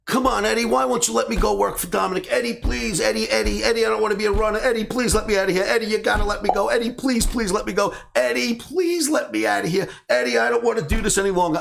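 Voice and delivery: high-pitched, insistent pleading without letup; pestering child. Retold or imitated. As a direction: nag like a kid on a car trip. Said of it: "like a child with his father saying".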